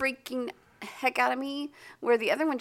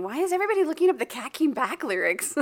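fast, higher mock bewilderment; imagined baffled internet; 5 semitones higher, 4 dB louder, faster than usual. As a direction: playfully act out a puzzled crowd. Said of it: "breaking up saying".

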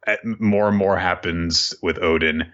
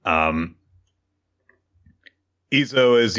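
usual speaking voice

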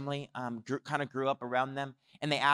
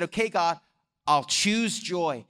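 louder, slow declaration of a decision; emphatic resolve; 3 semitones higher, 5 dB louder, slower than usual. Retold or imitated. Preacher retells Jeff's yes to God, emphatic resolve.

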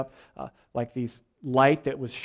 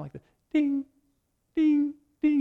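much higher sung dings like a trailer piano; piano ding imitation; 11 semitones higher than usual. Preacher imitating the movie trailer piano, high sung dings. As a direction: hum the dramatic trailer piano notes. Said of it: "that little piano in the background".

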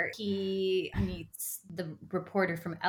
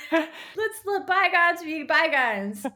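mock breezy Duke; 7 semitones higher, 4 dB louder, slower than usual.

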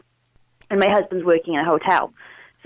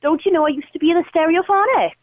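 put-on Welsh accent; 7 semitones higher than usual. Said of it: "how about this one?".

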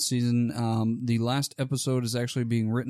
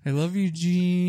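lovesick voice; 5 semitones higher, 3 dB louder, slower than usual. Acted. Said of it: "Start making out with jeans".

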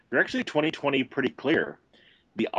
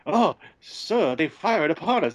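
mock C-3PO; 6 semitones higher than usual. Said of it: "C three P O is like".

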